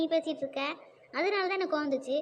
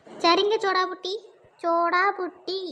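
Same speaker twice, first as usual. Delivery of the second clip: loud, drawn-out teasing taunt; taunting name-calling; 6 dB louder, slower than usual. Acted. Sister mocking her sibling with a nickname, loud taunt. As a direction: stretch the nickname out loudly to needle her.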